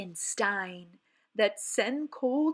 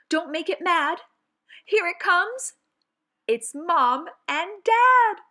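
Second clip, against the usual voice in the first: rising louder and higher to a big reveal; building to a shout; 8 semitones higher, 9 dB louder than usual. Acted. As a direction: build the tension, then shout the punchline. Poem builds to scary reveal.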